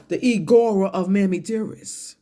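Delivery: ordinary talk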